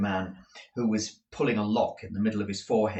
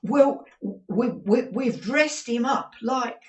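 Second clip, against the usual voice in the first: high, stammering nervous reply; 6 dB louder, slower than usual. A narrator's reading. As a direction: falter anxiously. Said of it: "to reply in a nervous manner".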